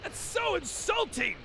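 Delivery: high, pushed fake yell; Raditz voice. Shown as a demonstration. A voice actor demoing Raditz, outraged at the insult. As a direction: act like yelling but don't yell. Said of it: "a pretty good Raditz voice".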